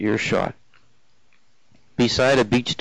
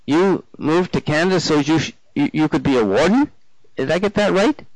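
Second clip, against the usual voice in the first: higher, halting questions; puzzled woman; 5 semitones higher than usual. Acted. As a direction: feel your way to the question, ending high. Narrator voices the questioning woman.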